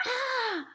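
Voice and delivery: high, loud roar of terror. A narrator's reading. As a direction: let out a high, panicked roar. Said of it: "roared the flesh lump eater".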